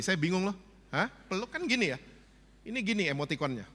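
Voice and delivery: usual speaking voice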